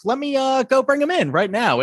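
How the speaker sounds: perky assistant eagerly offering; chirpy Ashley. Retold or imitated. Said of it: "So he agreed".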